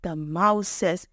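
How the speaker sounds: usual speaking voice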